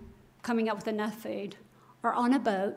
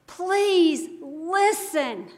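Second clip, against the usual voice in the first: very slow, higher, drawn-out plea; pleading Jesus; 4 semitones higher, 3 dB louder, much slower than usual. Retold or imitated. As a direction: stretch each word like a gentle begging. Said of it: "I hear Jesus in this going".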